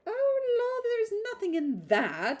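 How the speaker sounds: very high, loud breezy exclamation; silly gushing girl. A narrator's reading. Miss Steele dismissing Eleanor's reproach, giddy. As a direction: toss it off lightly, high and giddy.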